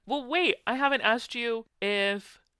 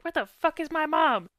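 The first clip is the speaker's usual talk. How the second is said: higher, louder grumpy dog voice; 9 semitones higher, 6 dB louder than usual. Retold or imitated. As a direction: voice a cursing dog. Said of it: "say something for her".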